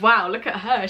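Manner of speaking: usual speaking voice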